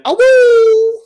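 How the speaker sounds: high wolf howl; howling like a wolf pup. Retold or imitated. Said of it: "And the howling at the end".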